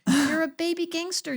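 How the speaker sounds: high excited teasing; playful teasing. Real, not acted. Guest teasing the host, high playful glee.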